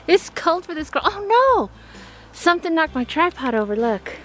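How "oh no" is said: high startled cry; surprised. Real, not acted. Shocked to find her tripod knocked over. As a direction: gasp it out in alarm.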